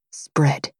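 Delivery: a low whispered command; whispered order. Acted.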